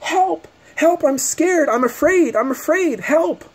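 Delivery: high, hammed-up cries for help; imitating the lady faking fear to the couple; mock fake panic. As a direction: parody her faked fear. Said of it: "she turns to them and she goes".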